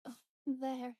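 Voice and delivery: ordinary talk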